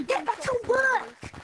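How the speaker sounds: slow stern boss orders; harsh barking commands. Pretend. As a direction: bark orders like a slave-driver boss. Boss ordering monkeys to work.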